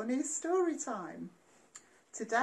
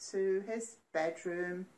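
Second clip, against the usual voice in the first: plain reading voice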